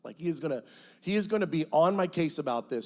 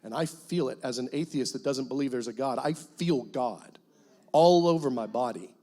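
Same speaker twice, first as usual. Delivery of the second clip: ordinary talk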